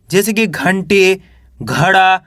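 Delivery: ordinary talk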